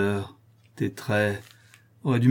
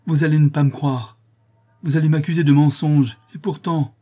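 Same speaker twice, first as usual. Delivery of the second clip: higher, louder anxious plea; fearful outcry; 5 semitones higher, 5 dB louder than usual. A narrator's reading. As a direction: cry out, afraid of not being believed. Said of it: "s'écria Louise, en s'interrompant avec crainte".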